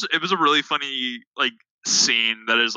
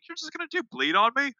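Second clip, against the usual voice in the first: mock taunt; 12 semitones higher, 4 dB quieter than usual.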